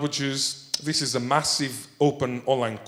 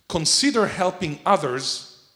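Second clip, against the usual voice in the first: ordinary talk, nothing marked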